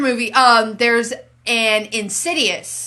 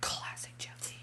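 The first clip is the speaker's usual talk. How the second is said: whisper; 23 dB quieter than usual. Real, not acted. Whispered aside about movie.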